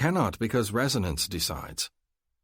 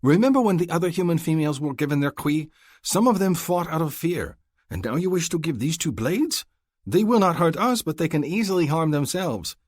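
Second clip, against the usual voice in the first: higher, louder voice of a worried, stubborn hunter; 11 semitones higher, 5 dB louder than usual. A narrator's reading. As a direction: argue hard against arming the women. Hassan voiced at length.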